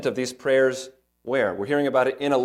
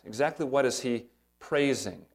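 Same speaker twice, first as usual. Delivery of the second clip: usual speaking voice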